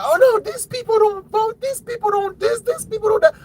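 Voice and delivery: very high whiny mock voice; mocking deniers. Commentator parodies people denying voter fraud, mocking high-pitched voice. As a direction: squeal their denials in a mocking high pitch. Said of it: "as much as they claim that".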